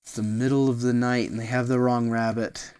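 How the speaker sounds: usual speaking voice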